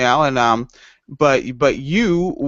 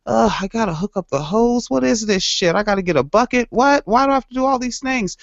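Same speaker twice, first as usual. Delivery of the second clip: whiny griping; 8 semitones higher than usual. Pretend. Griping about carpet chores.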